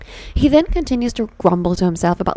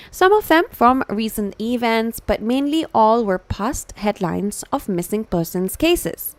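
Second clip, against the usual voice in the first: usual speaking voice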